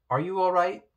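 ordinary delivery, nothing marked

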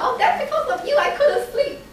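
high, laughing mock reproach; laughing complaint. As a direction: tease her through a laugh. Friend laughing at the speaker.

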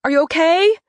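high, loud worried call. Acted. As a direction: call into the house for him. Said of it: "I call out".

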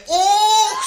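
high, loud mock-shocked "och"; shocked exclamation. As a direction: cry out in comic shock. Patient reacts to doctor in joke. (geschrokken uitroep)

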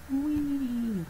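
high, soft squeal; a playful little whee; silly wee. Pretend. Gamer voicing a spinning creature, high squeal.

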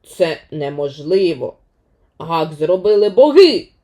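a touch of a gloomy demigod; disconsolate Maui. Acted. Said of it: "невтішного Мауї".

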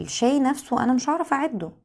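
ordinary delivery

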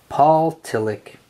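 plain reading voice

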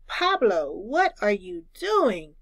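usual speaking voice

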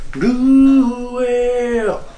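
long, slow, high drawn out whale moan; whale call. Pretend. Showing off a whale call to friends, slow and high. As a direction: stretch it out like a whale song.

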